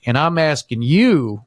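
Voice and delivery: slow, deliberate insistence; retold shakedown, drawn out and firm; pointed demand. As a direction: press the point word by word. Staff pressing retold, slow and pointed.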